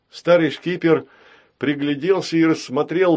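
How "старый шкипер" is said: ordinary delivery, nothing marked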